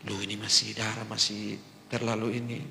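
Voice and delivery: soft, calm counsel; fatherly advice. Retold or imitated. Old man quietly counsels the pastor, soft and calm. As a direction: gently advise a hot-headed youngster. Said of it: "Dia nasihat beta".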